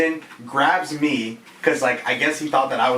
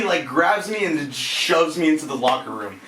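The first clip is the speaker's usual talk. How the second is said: ordinary talk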